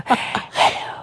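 soft unvoiced ASMR hello; ASMR girl whisper. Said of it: "this girl's go".

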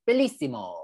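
laughing fake Italian, high; hammed-up faux Italian; Italian accent. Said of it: "[name] loves to be faux Italian".

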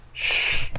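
whispered shush. Acted. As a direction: hush him. Hushing the boy.

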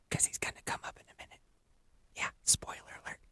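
quick, hushed stage whisper; very soft, fast whisper; whispered aside. Pretend. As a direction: whisper a secret to the listener. Whispered aside, echo joke.

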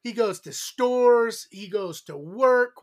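loud, punchy show-opening announcement; podcast intro. Acted. Host hypes up show and co-host to listeners, big intro voice. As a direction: announce it big, stretching out key words.

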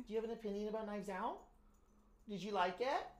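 high, soft, coaxing questions; soft pet talk. Pretend. Co-host asks baby Brucey his opinion, soft high pet talk. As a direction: coax a pet in a small high voice.

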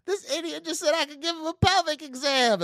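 high, loud amused nurse voice; a nurse laughing to her coworkers about the idiot patient; mocking nurse voice. Nurse telling others, voiced.

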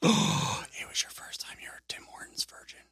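gasped, hushed whisper. Real, not acted. Whispering in mock shock to his friend. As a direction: lean in and whisper the news.

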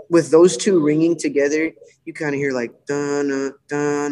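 usual speaking voice

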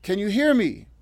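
high, loud urgent shout; screamed question. A narrator's reading. Natalie calling to revived Emily through glass, screaming. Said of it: "she screamed".

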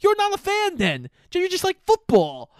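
high, exasperated mock rant; mock outrage. [name] retorting to Rod in high mock outrage. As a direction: scold him in a squeaky fake fury. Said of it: "I'm like".

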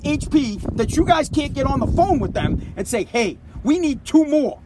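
worked-up angry tirade; all fired up. Retold or imitated. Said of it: "I'm getting all fired up".

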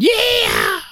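high shouted cheer; excited yell. Retold or imitated. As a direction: yell it out triumphantly. Acted quote of cheering man.

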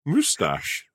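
"Moustache" said as a drawn "moo-stash", mimicking a friend; mock the posh way of saying "Moustache"; mocking pronunciation.

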